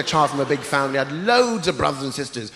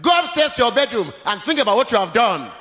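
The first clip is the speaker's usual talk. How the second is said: stern dad; 7 semitones higher, 3 dB louder than usual. Retold or imitated. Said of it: "My dad looked at me and went".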